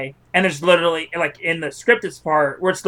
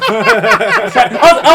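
laughing; 7 dB louder, much slower than usual. Real, not acted. Laughing while telling story.

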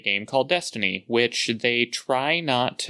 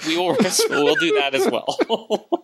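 hiccup giggles; 11 semitones higher than usual. Real, not acted. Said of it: "Stop making me laugh".

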